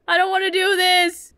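high, reluctant wail; dreading protest. Real, not acted. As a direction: whine it out in dread. Dreads someone at the door.

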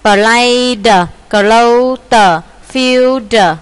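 "Played, clothed, filled" with each final d released as its own beat; đọc mẫu đuôi ed. Shown as a demonstration. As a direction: loudly sound out the endings of "Played, clothed, filled". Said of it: "Đằng sau chữ tờ đờ đọc là it". (đọc rõ âm cuối "Played, clothed, filled")

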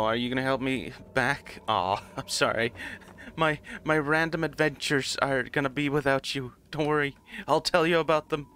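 usual speaking voice

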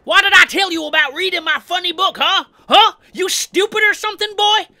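high, loud character rant; Hitchhiker's angry threat. Acted. Hitchhiker threatens Leatherface over his comic book, yelled high and angry. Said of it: "he uses this as an excuse to start another fight".

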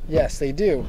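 ordinary delivery

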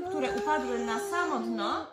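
ordinary talk, nothing marked

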